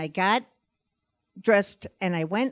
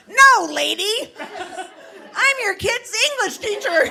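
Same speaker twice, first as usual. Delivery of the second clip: exasperated; 13 semitones higher, 6 dB louder than usual. Retold or imitated. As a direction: spell it out, fed up. Teacher's exasperated retort.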